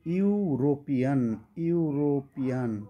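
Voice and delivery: slow, drawn-out ইউরোপিয়ান, starting with a clear "you" sound; teacher modelling ইউরোপিয়ান slowly for students; careful pronunciation. Shown as a demonstration. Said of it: "খেয়াল করে এবার একটু".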